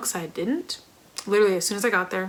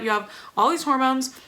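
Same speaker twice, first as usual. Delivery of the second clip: usual speaking voice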